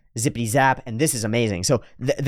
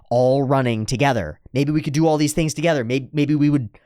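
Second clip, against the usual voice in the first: ordinary talk, nothing marked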